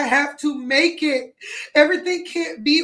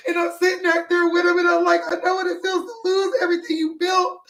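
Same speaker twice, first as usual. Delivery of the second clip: higher voice, close to sobbing; tearful; 4 semitones higher than usual. Real, not acted. Streamer identifying with those who lost everything, crying. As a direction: speak through real tears, pitch lifted. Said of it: "I'm sorry, I'm crying on live".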